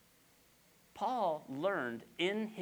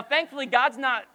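ordinary talk

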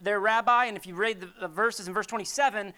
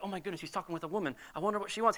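gossipy aside; 4 semitones lower, 6 dB quieter, much faster than usual. Retold or imitated. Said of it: "They're like".

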